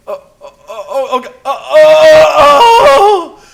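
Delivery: long loud high wailing oh's, half laugh half cry; man losing control in a car, wailing; desperate groaning oh's. Retold or imitated. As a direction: wail it out in desperate relief. Desperate groans while soiling himself.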